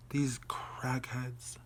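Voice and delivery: slow, disbelieving whisper; drawn-out exasperated whisper; whispered aside. Retold or imitated. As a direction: mutter it voicelessly under your breath. Retold muttered aside in disbelief.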